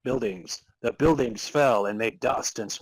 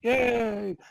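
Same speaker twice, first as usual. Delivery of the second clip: high cheer; 10 semitones higher than usual. Acted. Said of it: "everyone around Henry Freeman said".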